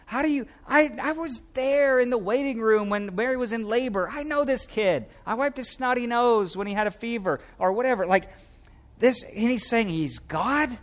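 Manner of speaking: put-on high, incredulous neighbor who knew him as a kid; high, disbelieving gossip voice; scoffing neighbor. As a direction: play a townswoman who can't believe it. Neighbor scoffing at Jesus' claim.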